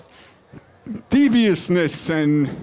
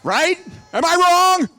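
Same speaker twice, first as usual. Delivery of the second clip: villain taunt; 9 semitones higher, 3 dB louder than usual. Acted.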